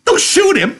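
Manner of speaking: very high, loud outraged cry; Curly crying out. Acted. Curly mocks George's plea, outraged shout. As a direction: scoff at the idea, outraged. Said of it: "Curly cried".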